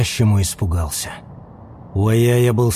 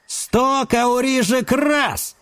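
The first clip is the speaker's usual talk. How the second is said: high, slow auctioneer proclamation; proclaiming auctioneer; 11 semitones higher, much slower than usual. A narrator's reading. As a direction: call it out to the room. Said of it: "провозгласил Том".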